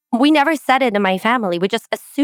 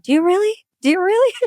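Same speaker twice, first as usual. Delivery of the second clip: mock doubting, much higher, rising; a high, incredulous "really?"; skeptical; 11 semitones higher, faster than usual. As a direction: squeak it out in disbelief. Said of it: "And it's like".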